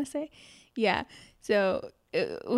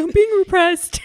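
high, loud hammed-up yell; mock cry of protest; 11 semitones higher, 10 dB louder than usual. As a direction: wail it out like a comic victim. Repeats peasant's cry.

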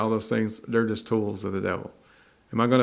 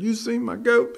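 put-on much higher, urgent old farmer calling out; panicked farmer; 12 semitones higher than usual. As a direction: ask like a frantic farmer hunting his goat. Frantic farmer asks townsfolk.

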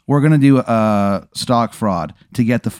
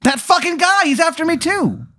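very high squeal of outrage; alarmed outburst. Acted. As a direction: squeal it in sudden recognition. Character alarmed about the pursuer.

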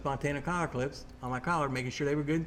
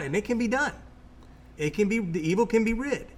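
fervent; 6 semitones higher than usual. Retold or imitated. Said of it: "I'm a child of God".

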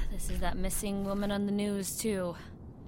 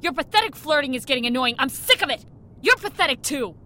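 fast, higher and louder, rising fury; angry outburst; 7 semitones higher, 8 dB louder, faster than usual. Acted. Woman telling partner off for flirting, rising anger. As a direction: let him have it fast.

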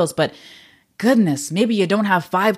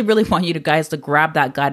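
normal talking voice

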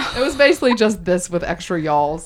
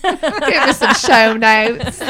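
drawn-out, louder Southern show notes, laughing; Southern accent; 5 semitones higher, 5 dB louder, much slower than usual. Pretend. Host mocks her own slip to co-host in a Southern accent. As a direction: stretch the vowels in a hammed-up Southern accent. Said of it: "in my Southern accent".

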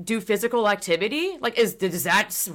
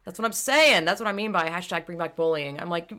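ordinary delivery

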